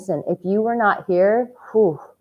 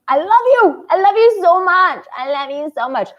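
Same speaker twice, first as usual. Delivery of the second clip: high, loud gushing love; signing off with a gushing burst of love; 10 semitones higher, 5 dB louder than usual. Real, not acted. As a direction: pour out affection with delight.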